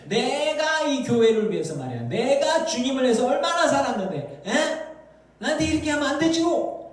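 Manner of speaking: high, indignant mock grievance; acting out an entitled complainer; self-righteous anger. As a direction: boast of your service, building to protest. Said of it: "분노가 있다면 그거는 자기 공로 의식에서".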